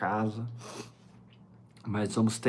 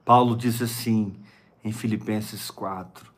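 usual speaking voice

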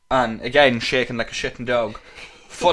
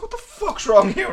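high, slow puzzled cry; mock bewilderment; 3 dB louder than usual. Retelling waking up with itchy feet, high slow mock confusion. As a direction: act baffled by your own itchy feet.

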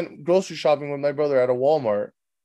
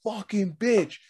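retold outraged rant building to a high shout; cussing; 7 semitones higher, 6 dB louder than usual. Man cussing in the car about her insult, mock outrage. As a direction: act out indignant cussing, louder and higher. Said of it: "I started cussing".

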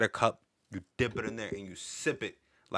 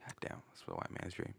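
hushed; 3 semitones lower, 18 dB quieter than usual. Pretend. Hushed mock remark.

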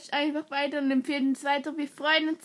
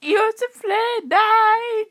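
mock yell; 8 semitones higher, 8 dB louder, much slower than usual. Kids yell at Josef Le.

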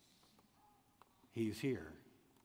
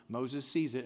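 normal talking voice

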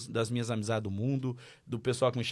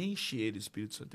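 hushed prayer; 3 semitones lower, 6 dB quieter than usual. Retold or imitated. Pastor ora, voz baixa.

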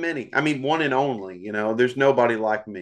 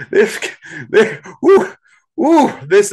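excited woo, pitched way up; 6 semitones higher, 9 dB louder, slower than usual. Real, not acted. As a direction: whoop it up with excitement.

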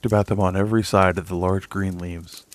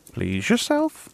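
much higher, dismissive; huffy old duck; slower than usual. A narrator's reading. Old duck dismisses the stubborn mother, high huffy voice. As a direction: toss it off and waddle away.

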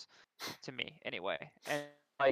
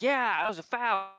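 smug retort; 7 semitones higher, slower than usual. Retold or imitated. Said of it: "the guy's like".

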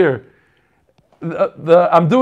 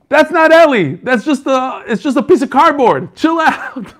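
higher, louder voiced reply with a laugh at the end; the other guy; 6 semitones higher, 3 dB louder than usual. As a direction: lightly play the guy telling him to chill. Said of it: "the guy tells him".

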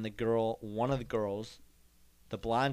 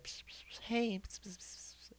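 unvoiced psst hissing to a friend; girl whispering to her friend, psst; whispered psst; 9 semitones higher, 10 dB quieter than usual. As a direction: hiss psst, psst to get her attention. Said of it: "is like".

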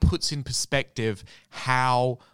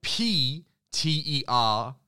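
letter by letter, slowly; spelling it out; slower than usual. Shown as a demonstration. Spelling the name out for radio listeners, slow and clear.

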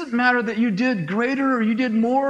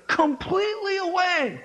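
higher, laughing echo of completely away; excited repeat; 6 semitones higher than usual. Shown as a demonstration. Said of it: "His headache went completely away".

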